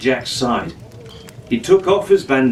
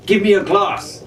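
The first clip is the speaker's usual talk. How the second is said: demanding; 7 semitones higher than usual. A narrator's reading. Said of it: "he demanded".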